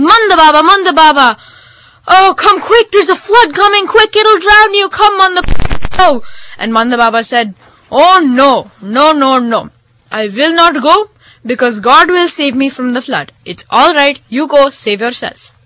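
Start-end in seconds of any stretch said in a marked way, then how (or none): 0.0-6.2 high, loud shouted warning; urgent yell. Acted. Man at the door warning of a flood, urgent yell. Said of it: "and the man yelled". 7.9-15.5 lightly voiced, emphatic holy man; stubborn holy man. Holy man refusing rescue from the flood, stubbornly assured.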